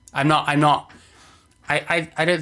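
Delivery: stumbling, repeating the first words; stuttering. Real, not acted. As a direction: stammer through the start of it. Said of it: "I, I do stutter".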